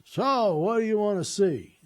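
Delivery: loud, high-pitched hearty character voice; imitated older gentleman. Bearded old guide greeting a waiting visitor, loud imitated voice. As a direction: boom it out high, imitating a portly old fella. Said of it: "and he goes".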